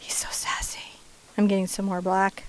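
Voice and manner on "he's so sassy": breathy whisper, delighted; hushed little aside about the sassy bird. Acted. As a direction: let it slip out as a secret.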